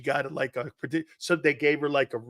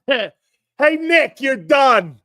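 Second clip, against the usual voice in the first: high, loud jeer with drawn-out, slow words; heckling fan shout; 11 semitones higher, 10 dB louder, much slower than usual. Retold or imitated. Repeating the Philly fans' heckle at the coach, long jeering shout. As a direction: holler it across a stadium, stretching it out.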